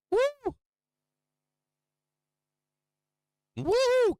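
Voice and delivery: high, loud celebratory woo; excited whoop. Real, not acted. Streamer cheering his chat after the target hits, loud high whoop. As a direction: cheer it out like you just won.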